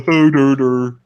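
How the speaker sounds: loud, dopey doggy noises; optimistic dopey dog. Shown as a demonstration. Host showing the audiobook accent, optimistic and dopey. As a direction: sound like a cheerful, simple dog. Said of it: "a very optimistic".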